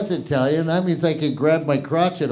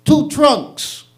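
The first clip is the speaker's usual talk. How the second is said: high, punchy mock mafia line; comic mobster punchline; mafia deadpan; 11 semitones higher, 4 dB louder than usual. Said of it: "And he goes".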